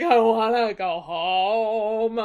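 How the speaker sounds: slow, drawn-out fake wail; mock crying. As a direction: whine it out like a homesick hero. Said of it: "He's crying on the beach".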